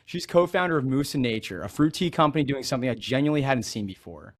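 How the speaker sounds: ordinary talk, nothing marked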